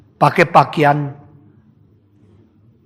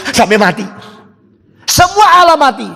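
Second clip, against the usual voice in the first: ordinary talk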